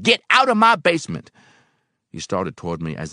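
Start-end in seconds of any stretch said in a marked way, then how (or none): 0.0-1.3 high, loud furious eviction; screamed order. A narrator's reading. Old man throwing helper out of basement, screaming. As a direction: scream it to drive him out. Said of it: "he screamed".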